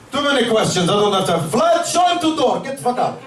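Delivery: put-on impatient Russian accent, lightly done; a hint of a gruff Russian boss. Russian owner throws the customer out in a light, impatient Russian accent. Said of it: "Russian dispensary owner".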